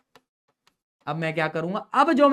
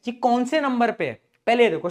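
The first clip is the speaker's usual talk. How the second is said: ordinary talk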